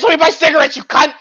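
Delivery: ordinary talk, nothing marked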